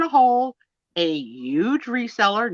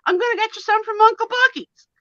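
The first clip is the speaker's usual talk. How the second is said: imitated Southern accent; 12 semitones higher than usual. Mishearing her friend's promise, mimicking her in a high Southern accent. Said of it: "but I heard".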